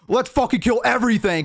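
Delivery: put-on high, eager young orc; young orc's bloodlust. Young orc declaring himself to the world, bloodthirsty. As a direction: pitch it up, a zealous young fighter. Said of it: "so he was like".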